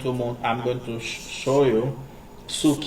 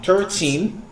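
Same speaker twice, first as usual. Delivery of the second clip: put-on slow "thirteen" rising on TEEN; stress on TEEN; 5 semitones higher than usual. Shown as a demonstration. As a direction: lean on the last syllable of "thirteen". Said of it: "Stress out on teen".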